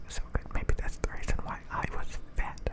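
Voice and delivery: normal talking voice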